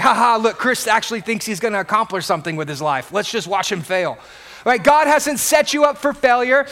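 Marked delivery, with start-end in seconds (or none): 0.0-4.2 low, quick mock snickering aside; mocking laughter. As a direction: pretend to laugh at someone behind their back. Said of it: "they start laughing at you".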